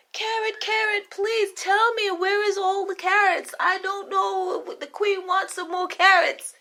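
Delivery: jittery cook in a squeaky high voice; nervous cook. Acted. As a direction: stammer excuses to your queen. Cook calls the carrots.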